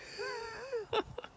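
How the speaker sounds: squeaky burst of laughter; high laugh. Real, not acted. Comedian cracking up at his own story, a high squeaky laugh.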